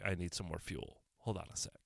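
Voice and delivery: usual speaking voice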